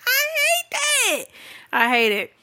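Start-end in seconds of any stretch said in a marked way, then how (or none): 0.1-1.2 very high, drawn-out squeal; squeaky, exaggerated exasperation; exasperated squeal. Real, not acted. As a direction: squeal it slowly in comic disgust. Comic exasperation after mocking posts.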